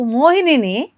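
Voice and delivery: ordinary delivery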